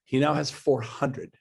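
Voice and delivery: usual speaking voice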